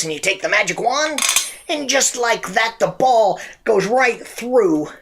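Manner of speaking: showman drawing out the big moment; magic shop pitchman. Acted. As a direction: stretch it out like a stage magician. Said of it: "He says".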